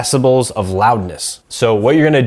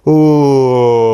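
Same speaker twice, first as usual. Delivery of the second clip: ooh pushed down; 5 dB louder than usual. Shown as a demonstration. Coach pushes the balloon down, long sinking ooh. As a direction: let the held ooh sink further. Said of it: "push it down even further".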